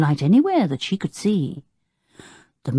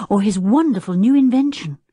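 plain reading voice

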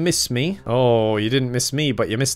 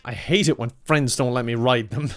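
normal talking voice

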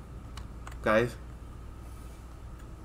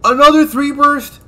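high, loud, drawn-out disbelief; outraged yell; 11 semitones higher, 11 dB louder, slower than usual. Real, not acted. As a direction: shout it slowly in outrage. Same gun yet again.

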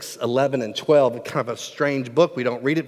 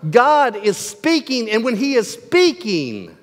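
raised, insistent pulpit voice; forceful preaching; 6 semitones higher, 5 dB louder than usual. Real, not acted. Preacher challenging the church to respond, forceful preaching. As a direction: press the congregation for a response.

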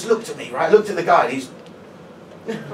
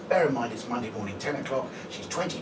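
ordinary talk

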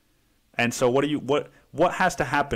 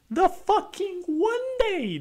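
squealing exasperation; slower than usual. Real, not acted. Exasperated rant, peaks higher.

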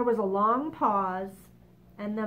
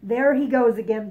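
much louder exasperated cry; earthworm crying out; 12 dB louder than usual. Acted. Earthworm cries out at the boastful centipede, loud. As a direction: fling it out in exasperation. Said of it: "the earthworm cried".

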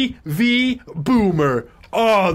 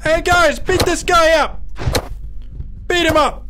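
rally the others to attack; urgent high call; 9 semitones higher than usual. Real, not acted. Calling players to gang up.

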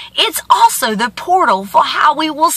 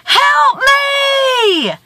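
high, drawn-out cry for help; long high call, held to the end; screamed plea; 13 semitones higher than usual. A narrator's reading. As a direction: holler for rescue across a valley. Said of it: "He screams again".

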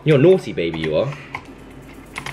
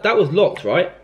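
ordinary talk, nothing marked

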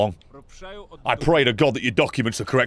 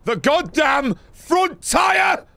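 high, slow, outraged yell; furious shout; 12 semitones higher, much slower than usual. Real, not acted. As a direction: bellow it, stretching each word. Furious at the hidden stash.

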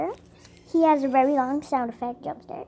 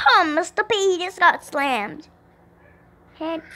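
usual speaking voice

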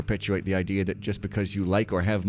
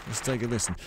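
ordinary delivery

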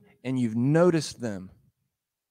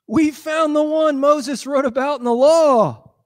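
high, slow, eager announcing; excited news; 6 dB louder than usual. Acted. Philip bursting to tell his friend the news, high and eager. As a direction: tell him the big news with wonder.

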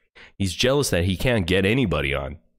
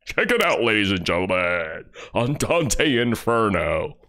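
mock announcer; 3 semitones higher than usual. Mocking the host as a cheesy announcer.

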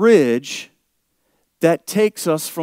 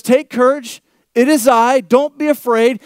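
proclaimed aloud; 7 semitones higher, 5 dB louder than usual. Acted. Preacher repeats Jesus reassuring them.

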